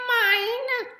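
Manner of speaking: very high, loud troll squeal; high greedy troll cry; clamoring trolls. A narrator's reading. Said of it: "they clamored".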